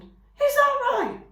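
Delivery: a polite little skunk, intrigued. A narrator's reading. Skunk politely listening to Roz, high and drawn out.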